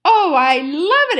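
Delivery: loud, high gush of delight; exclaiming with delight. Acted. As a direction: rave over the gift tooth. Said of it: "exclaimed the fox".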